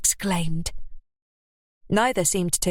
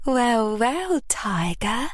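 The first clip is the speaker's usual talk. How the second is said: purred; 4 semitones higher, much slower than usual. A narrator's reading. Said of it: "she purred".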